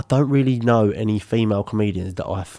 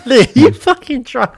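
laughing; 13 semitones higher, 5 dB louder than usual. Real, not acted. Teasing co-host mid-laugh.

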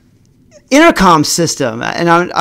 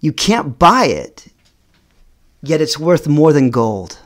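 ordinary delivery

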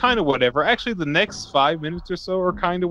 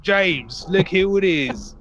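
higher-pitched, hammed-up Cockney, slowing in the middle; bad Cockney accent; 3 semitones higher, slower than usual. Villain greeting captured spy James, in a hammed-up bad Cockney accent. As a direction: badly fake a Cockney villain. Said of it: "They're just all gonna be cockney".

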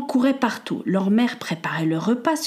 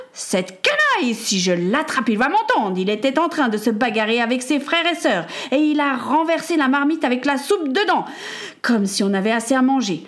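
higher-voiced angry rant; exasperated mother; 4 semitones higher than usual. Mother complains about Peter upsetting the soup pot, exasperated. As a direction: rant about a troublesome son.

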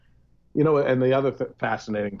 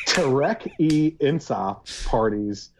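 slow name; 2 semitones higher, much slower than usual. Shown as a demonstration. Slow careful foreign name.

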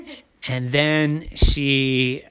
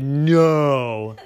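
emphatic refusal; 2 semitones lower than usual. A narrator's reading. Daddy refuses.

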